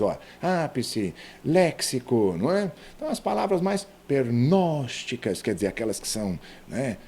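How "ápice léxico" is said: "ápice, léxico" each hit on the first syllable; weight on Á in "ápice", LÉ in "léxico"; antepenultimate stress. Shown as a demonstration. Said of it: "já estão acentuadas". ("ápice, léxico" com a primeira sílaba bem tônica)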